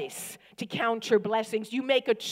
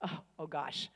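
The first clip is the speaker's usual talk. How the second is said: ordinary talk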